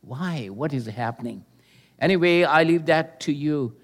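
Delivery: normal talking voice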